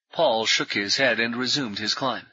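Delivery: ordinary delivery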